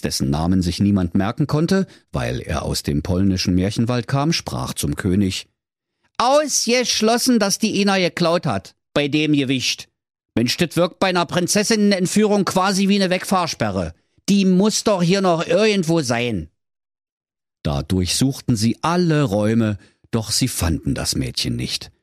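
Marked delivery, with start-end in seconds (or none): 6.2-16.5 high-pitched chamberlain, emphatically dismissive; servant's high, insistent objection; doubting old servant. A narrator's reading. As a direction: dismiss the idea in a high Berlin voice.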